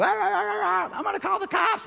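squealing high, panicked stammer; hammed-up shrieking driver at a red light; mock panic. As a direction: play a terrified driver.

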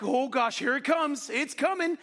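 rising dread, pitch climbing; mock panic as the crowd looms. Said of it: "I'm like".

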